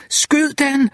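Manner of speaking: high-pitched urgent scream; screamed command. A narrator's reading. Jack screams at Wireman to shoot the alligator, high urgent scream. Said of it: "skreg Jack".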